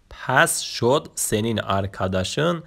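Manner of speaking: ordinary delivery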